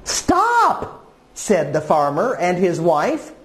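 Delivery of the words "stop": high, acted call to halt; high-pitched cry of stop. Farmer and wife to the goat eating plants, high acted cry. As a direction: call it out high, storybook style.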